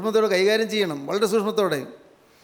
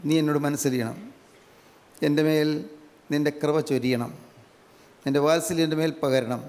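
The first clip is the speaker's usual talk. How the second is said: lower, softer heartfelt prayer voice; 6 semitones lower, 3 dB quieter than usual. Shown as a demonstration. Preacher models the congregation's prayer to the Lord, low soft prayer. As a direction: pray it humbly, low and soft. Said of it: "നിങ്ങൾ പറയണം കർത്താവിനോട്".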